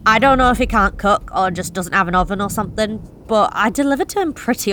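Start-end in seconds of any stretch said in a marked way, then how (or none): none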